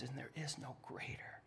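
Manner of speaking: hushed whisper of awe. Real, not acted. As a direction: breathe it almost silently. Sermon hushed climax.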